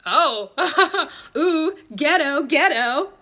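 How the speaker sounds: high, loud, laughing mock of him; mocking date. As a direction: play the smug date. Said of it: "He was like".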